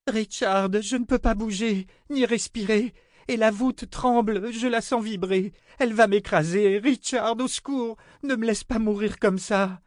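very high, desperate woman's voice; panicked pleading. A narrator's reading. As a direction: cry for help, trapped and at breaking point. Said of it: "cria Jensen à bout de résistance nerveuse".

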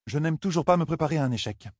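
usual speaking voice

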